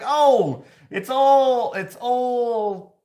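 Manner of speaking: hammed-up crowd call; hammed-up old-timey ballpark call; old-timey baseball voice. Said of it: "it's like".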